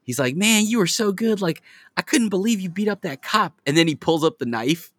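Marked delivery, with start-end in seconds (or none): 0.3-3.6 higher, excited impressed voice; high, eager mock admiration; imitating a character. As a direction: gush like an impressed teammate. Voicing movie character's admiration.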